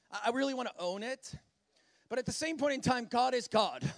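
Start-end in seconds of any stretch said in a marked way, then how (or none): none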